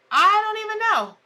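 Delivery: a real exasperated cry of not knowing. Real, not acted. Host baffled that her stream broke, exasperated.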